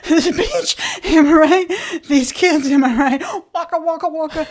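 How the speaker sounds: high, loud, over-eager joking; trying too hard. Retold or imitated. Comedian desperate for a laugh from the stranger, high loud over-eager joking. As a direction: push the jokes hard to win a smile. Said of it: "And so then I was like".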